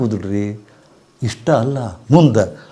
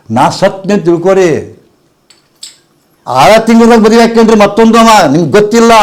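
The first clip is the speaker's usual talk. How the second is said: high, louder woman's voice, insistent; imitated fearful woman; 9 semitones higher, 6 dB louder than usual. Guru voicing the woman who fears cancer: high, insistent plea. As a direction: play the panicked disciple pleading. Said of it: "ಅಂತ ನನಗೆ ಆಕಿ".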